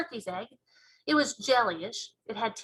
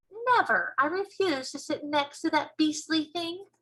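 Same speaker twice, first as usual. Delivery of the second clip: higher, emphatic indignant refusal; 6 semitones higher than usual. Acted. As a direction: refuse it outright, pitched up. Sophie refuses in story.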